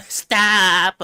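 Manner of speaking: high, loud drawn-out yell; sudden high-pitched holler; shouted stop. Real, not acted. Streamer yells out after laughing.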